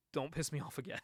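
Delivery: low, hushed retold warning; quiet menace. Father letting go of his daughter, quiet warning. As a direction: drop far down and soft for the threat.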